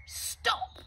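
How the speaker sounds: very high parrot squawk; shrieked stop. Acted. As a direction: squawk to end the fight. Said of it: "Polly squawked".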